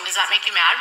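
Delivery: chewing while talking; talking through a bite of food; mouth full. Real, not acted. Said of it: "I am chewing".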